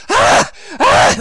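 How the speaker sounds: very high, loud anguished yelp; a yelp of crushed hope; dejected cry as the date falls through; pained yelp. Retold or imitated. Reacting to sudden letdown.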